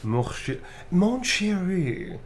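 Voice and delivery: high, slow, drawn-out "mon chéri"; romantic blushing line. Acted. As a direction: swoon it out like a lover. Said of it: "Romantic blushing type line".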